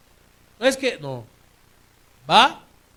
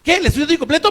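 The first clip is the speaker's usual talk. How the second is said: higher, louder flustered cashier; 9 semitones higher, 11 dB louder, faster than usual. Retold or imitated. Rushed cashier answering a customer, higher and flustered. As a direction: blurt it like a harried clerk. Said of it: "así como afanada".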